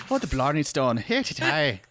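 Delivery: put-on high, stagey Irish accent; hammed-up Irish brogue; mock Irish. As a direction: lilt it up like a cartoon Irishman. Imitate accents, jokes about Ireland.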